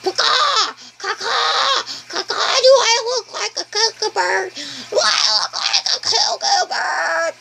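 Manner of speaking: high squawking bird cry; dragon squawk. Pretend. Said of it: "it flies around going".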